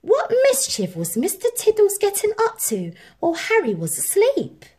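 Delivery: high, fast, playful question; teasing mystery. A narrator's reading. Narrator asking children, playful and curious. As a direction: tease the listener with the secret.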